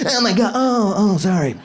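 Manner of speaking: high mock moaning; quick hammed-up fake orgasm; faking climax. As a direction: pretend ecstasy tipping into an apology. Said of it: "It's just better to be like".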